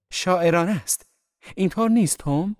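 very high, loud, gushing; rhapsodize, then press the listener to agree; effusive appeal. A narrator's reading. Daisy gushes, appeals to Tom. (پرشور، بلند و با صدای زیر)

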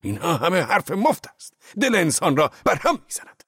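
high, loud, disgusted outburst; furious shout. A narrator's reading. Zorba shouting at his boss in furious disgust. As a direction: spit the words out in rage. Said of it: "با خشم و غضب فریاد زد".

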